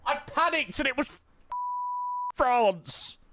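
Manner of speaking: high, squeaky panicked outburst; real flustered shriek at chat; panicked squeal. Real, not acted. Panicked reveal of wrong guess.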